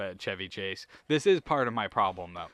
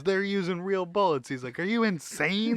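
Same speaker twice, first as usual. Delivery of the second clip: higher, louder alarmed voice; mock alarm; 9 semitones higher, 5 dB louder than usual. Retelling the scene, high alarmed voice. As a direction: blurt it in growing panic. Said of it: "He goes".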